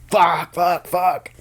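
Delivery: high-pitched mock scream at moderate volume; retold screaming. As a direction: re-enact a panicked scream without full volume. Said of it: "I'm just screaming".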